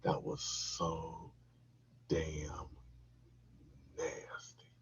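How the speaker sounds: slow, fading to a whisper; whispered inner disgust. Retold or imitated. His private thought after, slow whispered disgust. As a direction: let it trail into a whisper. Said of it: "kept thinking in my head".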